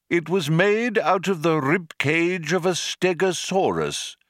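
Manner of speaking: lightly voiced old man, matter-of-fact; a hint of a fussy old man; old man explaining. A narrator's reading. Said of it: "explained the old man".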